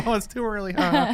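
a giggling customer teasing back; lightly voiced client.

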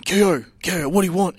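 calling out loud across the street; yelling. Retold or imitated. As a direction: shout his name to get attention. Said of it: "Lowry was getting pizza and was yelling".

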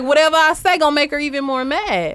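put-on high, loud kid's complaint; scolded child. Child thinking about an angry mother, exasperated.

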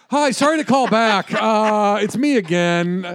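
high, loud, apologetic caller; hammed-up calling back on voicemail; mock repeat caller. Acts out caller ringing back.

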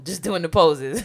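ordinary talk

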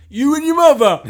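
high loud jokey yell; loud mock shout. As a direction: belt it out cheekily. Mock bingo call punchline.

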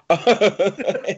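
laughing at own bit; high, giggly laugh; high giggle. Real, not acted. Laughing after the voice.

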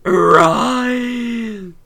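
wailing rise, rise, then a slow whispered my devils; demonic summoning. Acted. Granny sinks into the ground summoning devils, wail then whisper. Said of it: "melted into the ground while saying, "Rise, my devils".

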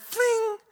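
very high, held "fling"; imitated flinging noise. Casting the line off the shore, high-pitched flinging noise. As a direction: sing out a cartoon cast-off noise. Imitating a cast sending shrimp flying.

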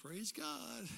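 very soft, heartfelt attempt; hushed rehearsal to oneself; earnest practice. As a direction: say it softly like you mean it. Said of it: "Just say it like you mean it".

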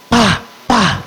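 two short vocal smacks; two sudden bursts mimicking slaps; imitated double slap. Father slaps his son to change the channel, two imitated smacks. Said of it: "slap me on the head".

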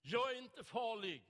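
loud, high bellowed declaration; bellowing. Retold or imitated. Burly man on tram roaring at passengers, loud bellow. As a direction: roar it across the whole tram. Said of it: "och så vrålar han".

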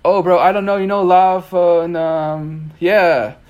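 high, loud clueless tourist; hammed-up dumb American act; playing dumb American. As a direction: feign confusion, stretching out the fillers. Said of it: "a dumb American disguise".

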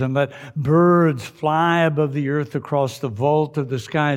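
loud, high, proclaiming scripture reading; booming biblical narration. Acted. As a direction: boom it out like a preacher.

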